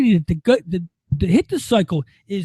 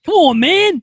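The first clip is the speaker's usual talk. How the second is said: loud exasperated; 7 semitones higher, 6 dB louder, slower than usual. Real, not acted. Repeats phrase, quiet then loud.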